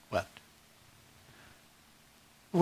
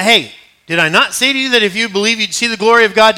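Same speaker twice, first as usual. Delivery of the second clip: louder, raised preacher's rebuke; emphatic challenge; 6 semitones higher, 9 dB louder than usual. Acted. Jesus reminds Martha what he promised, emphatic challenge. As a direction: push the question out forcefully, pitched up.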